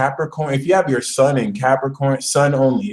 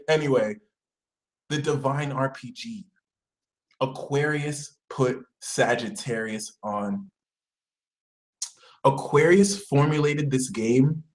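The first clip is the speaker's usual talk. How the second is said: ordinary delivery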